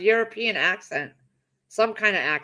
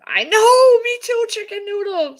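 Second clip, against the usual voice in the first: very high and loud gleeful agreement; high, loud happy squeal; excited squeal; 14 semitones higher, 8 dB louder than usual. Real, not acted. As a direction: squeal back at the chat. Excited reply to chat.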